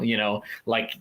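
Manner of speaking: normal talking voice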